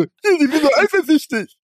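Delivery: high put-on mocking voice; mock whiny teen. Smug teen boasting to his mirror, put-on high voice. Mocking a jealous-kid fantasy voice.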